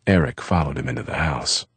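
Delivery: ordinary delivery, nothing marked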